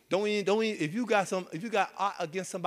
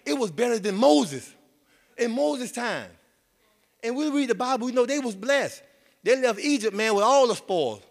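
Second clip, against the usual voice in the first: higher, louder preaching run; preaching at full force; 7 semitones higher, 4 dB louder than usual. Acted. Preacher exciting the congregation about Egypt's spoils, higher and louder. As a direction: lift the church with it. Preacher lifts pitch and volume.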